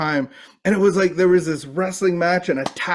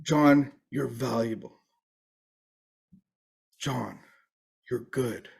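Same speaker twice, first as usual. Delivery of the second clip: much softer, hushed and kind; tender affirmation; 3 semitones lower, 7 dB quieter, slower than usual. Retold or imitated. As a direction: lay each word down gently. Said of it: "and said".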